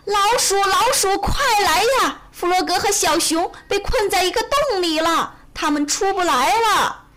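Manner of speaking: high-pitched urgent hollering; screaming for help. A narrator's reading. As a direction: yell for Mouse at the top of the voice. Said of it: "用最大的声音尖叫着". (高声尖叫着求救)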